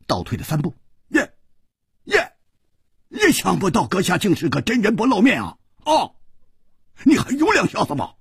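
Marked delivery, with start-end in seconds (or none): 1.2-8.2 high, rapid astonishment; amazed, excitable yelps; startled. A narrator's reading. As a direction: exclaim in disbelief, voice shooting up. Startled bully reacts to victim's return.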